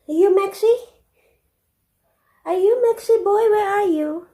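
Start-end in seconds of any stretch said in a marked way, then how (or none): none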